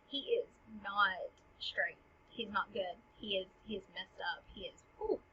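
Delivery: hushed, halting aside; confiding concern. Acted. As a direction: confide it softly, groping for words. King confides that Hamlet is unhinged.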